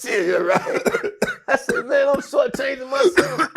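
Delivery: high squeal through laughter; real laughter breaking up the words; laughing hard. Real, not acted. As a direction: crack up while telling your own story. Cracks up at own story.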